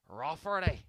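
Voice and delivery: Rafferty in a Belfast lilt, open ah, rolled ending; Northern Irish accent. Shown as a demonstration. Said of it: "critiquing my Northern Irish accent".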